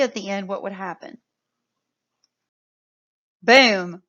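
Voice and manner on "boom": loud excited boom. Real, not acted. Teacher revealing changed word.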